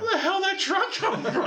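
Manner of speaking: very high, laughing mock bafflement; baffled Mom. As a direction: ham up a puzzled mom. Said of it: "And Mom going".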